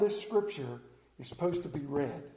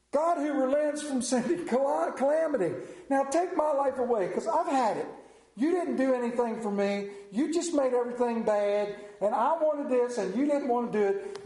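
Jonah's whiny tantrum, higher and pushed; sulking fit; 6 semitones higher than usual. Acted. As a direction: complain like a sulking prophet. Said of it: "stomping out in his little fit".

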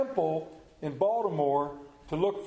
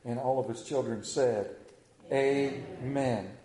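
low, soft prayer with long pauses; public prayer; 5 semitones lower, 4 dB quieter than usual. Real, not acted. Preacher asking the Father for strength today, prayed low and soft. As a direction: lead the congregation in prayer, lowered and unhurried.

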